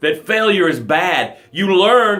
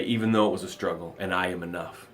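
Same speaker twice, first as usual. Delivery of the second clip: fading away; 11 semitones lower, 12 dB quieter, faster than usual. Retold or imitated. Reading viewer's comment, fading.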